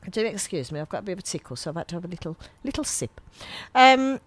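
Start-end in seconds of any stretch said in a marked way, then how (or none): none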